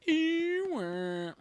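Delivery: high, loud, drawn-out whale moan; Dory speaking whale. Retold or imitated. Streamer doing Dory speaking whale, high loud moan. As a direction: stretch it like a whale call. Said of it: "repeats whale call".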